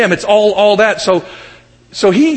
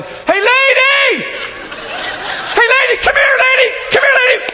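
high, stretched-out mock shouts for the dog; frantic owner calling a lost dog. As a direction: holler "Lady" up high down the street, half laughing. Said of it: "he was yelling".

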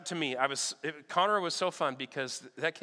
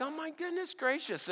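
amazed; 7 semitones higher, 7 dB quieter than usual. Retold or imitated. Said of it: "I'm like".

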